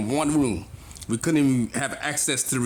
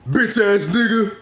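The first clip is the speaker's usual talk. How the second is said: slow, higher, louder sneering street insult; mock insult; 9 semitones higher, 6 dB louder, slower than usual. Mocking what old-timers expect him to say, slow sneering insult. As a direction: sneer it out like a tough guy picking a fight. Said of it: "to where you had to say".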